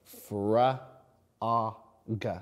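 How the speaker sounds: "fr, a, g" slowly, each sound apart. Shown as a demonstration. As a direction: split frog into "fr", "a", "g". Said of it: "I'm stretching out those words".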